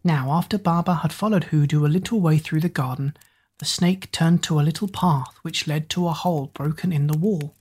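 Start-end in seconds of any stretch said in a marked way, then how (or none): none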